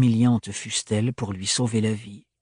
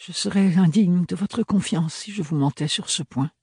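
higher, earnest voice; pleading to be believed; Ophélie nerveuse; 7 semitones higher than usual. A narrator's reading. (voix aiguë et sincère)

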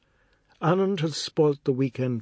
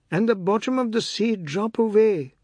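put-on upset boy; 9 semitones higher, 4 dB louder than usual. Boy voiced after near drowning.

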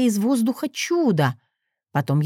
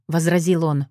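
ordinary delivery

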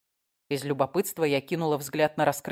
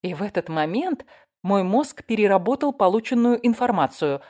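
ordinary delivery

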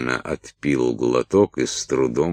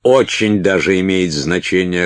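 louder, higher irritated snap; snapped retort; 5 semitones higher, 6 dB louder than usual. A narrator's reading. Уилт contradicts Салли's reassurance, snapping back. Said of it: "огрызнулся Уилт".

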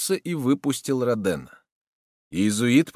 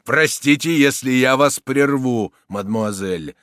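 firm, held-back politeness; restrained; 3 semitones higher, 7 dB louder, slower than usual. A narrator's reading. Soldier interrupting the lady, restrained. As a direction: interrupt courteously, feelings in check. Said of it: "сказал солдат сдержанным тоном".

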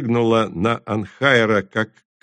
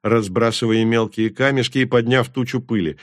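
plain reading voice

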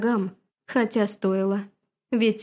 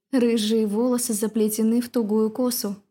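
plain reading voice

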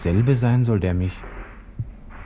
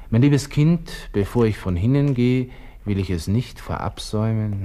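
grand letter recital; 4 semitones higher than usual. Acted. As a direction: launch the letter with mock ceremony. Second grander try at letter.

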